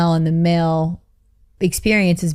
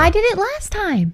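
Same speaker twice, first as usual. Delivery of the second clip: high squeaky protest; high-pitched. Acted. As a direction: pitch it way up, teasing. Playful bickering over who hosts.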